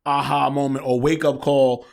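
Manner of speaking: usual speaking voice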